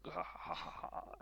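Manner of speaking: bold rallying laugh; heroic laugh. Retold or imitated. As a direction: laugh while charging forward. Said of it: "being like".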